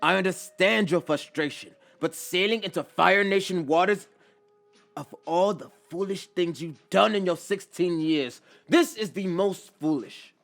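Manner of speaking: loud, high-pitched scolding; exasperated elder's telling-off; stern chastising. A narrator's reading. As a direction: scold a foolish youngster at full voice. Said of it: "Iroh chastises the boy".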